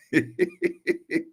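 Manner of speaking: high, chuckling laugh. Real, not acted. Host amused by his uncle story, high laugh. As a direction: laugh in short bursts.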